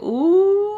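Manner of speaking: high, drawn-out "ooh"; mock teasing ooh. Men around him teasing like high schoolers, mock ooh. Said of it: "like, high school little bitches".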